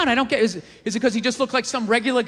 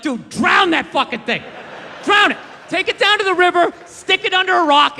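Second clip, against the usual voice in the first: mock shout. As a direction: yell it as if horrified by a baby. Acted outburst at baby Hitler.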